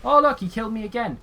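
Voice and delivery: high, loud, sarcastic complaint; annoyed exasperation. Real, not acted. Killed again in game.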